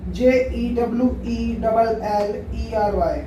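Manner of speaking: usual speaking voice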